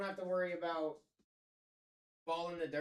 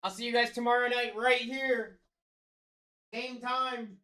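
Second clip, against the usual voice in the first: high, booming farewell call; loud sign-off; 8 semitones higher, 11 dB louder, slower than usual. Real, not acted. Guest leaving until tomorrow night, loud hollered sign-off. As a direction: holler goodbye to the whole audience. Guest calls goodbye to the stream.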